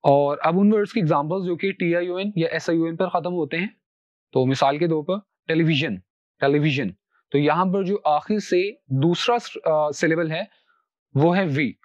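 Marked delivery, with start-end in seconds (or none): 5.5-6.9 "television" stressed on वि. Shown as a demonstration. Teacher models the rule, "television" with stress on वि. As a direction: lean on वि in "television", then fall. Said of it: "तो वहां पर हम stress देंगे".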